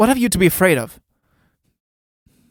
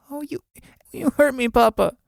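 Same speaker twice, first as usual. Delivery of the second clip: put-on whimpering child; 9 semitones higher than usual. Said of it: "Marion, whimpering".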